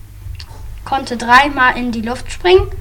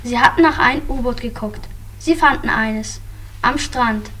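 ordinary delivery

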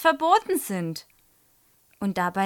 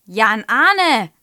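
sharply louder teacher's call; 2 semitones higher, 12 dB louder than usual. A narrator's reading. Teacher to pupil over his messy exercise book, calling out. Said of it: "ruft Frau Schröder".

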